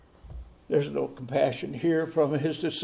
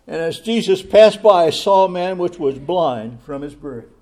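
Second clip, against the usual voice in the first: loud ringing scripture reading; preaching scripture loudly; 2 semitones higher, 14 dB louder than usual. Acted. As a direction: proclaim the verse to the whole hall.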